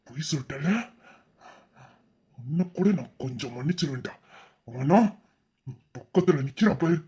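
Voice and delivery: low and soft, each word full of betrayal; bitter, quiet fury. Acted. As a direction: disown them quietly, seething. Betrayed friend disowns both. (நண்பனின் துரோகத்தால் மெல்லிய குரலில் கசப்பான கோபம்)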